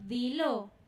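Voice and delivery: loud, low, firm order; firm command. Acted. The Lord answering a crying believer, firmly. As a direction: cut through the wailing with one firm order. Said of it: "Y el Señor".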